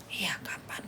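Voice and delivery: whispered eager question; breathy whispered curious reply. Acted. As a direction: ask back in a hush. Acted listener asks back.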